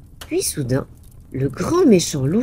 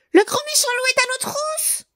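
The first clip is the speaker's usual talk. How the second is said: much higher piglets crying for help; panicked cry. A narrator's reading. The fleeing pigs begging their brother, high and panicked. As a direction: shriek it in panic. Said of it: "crièrent-ils".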